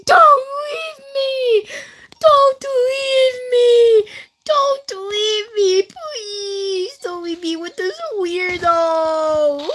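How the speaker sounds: high, slow, crying plea; mock panicked screaming. Kid begging the teacher not to leave, high crying wail. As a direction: wail it like you're being abandoned. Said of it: "in my mind, I was screaming".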